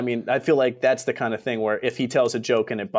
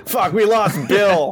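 laughing mock despair; 8 semitones higher, 4 dB louder, slower than usual. Real, not acted. Mock mourning lost listener.

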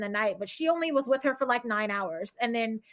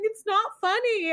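imitated tearful protest, pitched high; mock sobbing plea in a higher voice; retold sobbing; 9 semitones higher, 3 dB louder than usual. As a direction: act out a teary objection, high. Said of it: "I was, like, sobbing in the kitchen".

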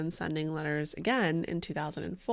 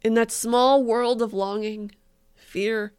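mock dramatic poetry reading; 8 dB louder than usual. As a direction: ham up the tragic verse.

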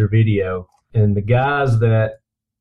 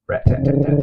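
mock gunfire; 3 semitones higher, faster than usual. Imitating machine gun fire.

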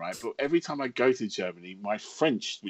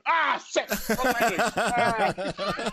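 mock dismay; 11 semitones higher, much slower than usual. Caught speaking French in a German cafe, comic dismay. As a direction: catch your own mistake in comic horror. Catching himself in wrong language.

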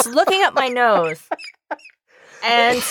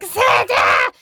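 put-on thrilled child shout. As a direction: scream it like a kid seeing Santa. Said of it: "this little girl's like".

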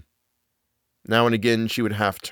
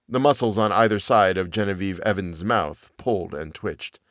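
ordinary delivery

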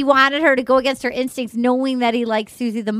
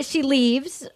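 ordinary talk

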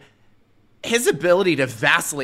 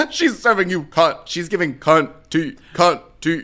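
sassy drag queen; 5 semitones higher, 4 dB louder than usual. Pretend. Hyping Mrs Doubtfire's look.

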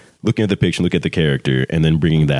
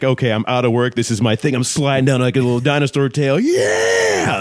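higher, building to a big high whoop; excited character energy; 7 semitones higher than usual. Shown as a demonstration. Coach demonstrating the catchphrase's energy, excited build to a whoop. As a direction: ride the excitement up into a long shout. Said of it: "that's with that energy right there".

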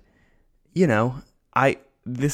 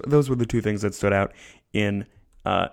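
ordinary talk

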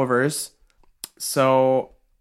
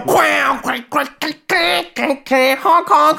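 high silly clown quacks and honks; 8 semitones higher than usual. Pretend. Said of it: "I hate that song. Fucking garbage clown".

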